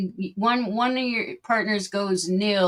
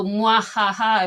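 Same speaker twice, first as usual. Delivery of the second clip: sneering villain laugh; evil laugh; 4 dB louder, slower than usual. Shown as a demonstration. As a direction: cackle like a panto villain. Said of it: "it becomes evil".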